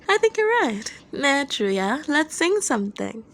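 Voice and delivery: high, musing Dazai; agree, then brighten with a new idea; thoughtful Dazai voice. Acted. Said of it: "Dazai replied thoughtfully".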